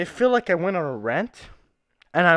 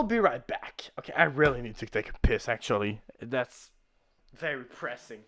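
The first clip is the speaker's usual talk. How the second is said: whispering; 10 semitones lower, 9 dB quieter than usual. Real, not acted. Whispering as he leaves the stream.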